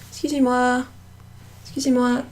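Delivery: high, polite call of "excuse me" in French; polite attention-getting. Shown as a demonstration. Teacher showing how to get someone's attention, polite high call. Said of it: "want to get someone's attention".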